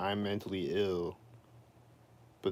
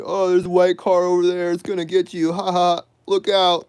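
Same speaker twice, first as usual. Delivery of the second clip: much higher, loud sneering tease; mocking taunt; 9 semitones higher, 12 dB louder than usual. Imitating the people who mock, high loud taunting voice. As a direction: ham up a cruel mocking voice. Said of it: "It's like".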